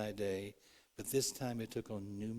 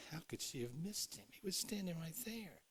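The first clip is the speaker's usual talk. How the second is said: higher and softer, mocking disbelief; mock incredulous, pitched up; scoffing; 7 semitones higher, 6 dB quieter than usual. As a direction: scoff at Mary's mistake. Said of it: "We laugh at Mary and say".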